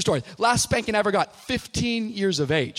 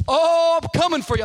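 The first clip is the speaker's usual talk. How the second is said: high, loud mock threat; menacing dad; 11 semitones higher, 8 dB louder than usual. Dad warning his son the belt is coming, mock menacing. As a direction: call out like a dad on the warpath.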